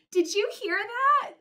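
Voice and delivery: high, thrilled question; can't hold in the glee; delighted. Acted. Piggy asking Gerald, thrilled.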